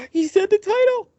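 a fan's thrilled squeal at the title drop; starstruck, gasping delight; excited gasp. Real, not acted. A fan gasps at a title drop.

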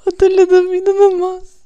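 high, loud laughing squeal; excited squeal. Real, not acted. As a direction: shriek with delighted recognition. Laughing recognition.

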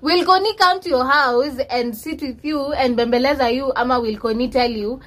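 ordinary talk

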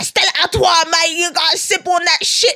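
loud, high-pitched mock London street British accent; Idris Elba. Comic mocking the actor's beer commercial, exaggerated British accent. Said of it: "talk in his British accent".

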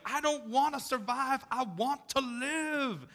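high-pitched, drawn-out plea; high quoted plea. Retold or imitated. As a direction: cry it out high and slow like the captain. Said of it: "And he's like".

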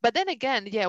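ordinary delivery

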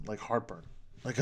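ordinary delivery

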